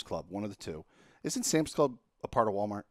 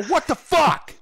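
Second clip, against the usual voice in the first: much higher and louder, a burst of disbelief; shocked outburst; 8 dB louder, slower than usual. Retold or imitated. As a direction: yell it at the screen as the cart turns up empty. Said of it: "And I go".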